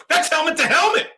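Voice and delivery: high, urgent call at the game; excited protest. Real, not acted. Viewer calling out a helmet hit, excited shout. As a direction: burst out protesting a foul.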